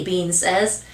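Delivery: ordinary delivery, nothing marked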